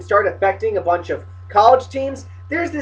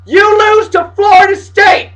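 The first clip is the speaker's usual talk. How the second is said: high, loud accusing yell; indignant shout; 9 semitones higher, 6 dB louder than usual. Pretend. Fan telling Notre Dame what it did, indignant shout. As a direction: blast the team with the answer. Answers own taunt to team.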